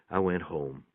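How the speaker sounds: usual speaking voice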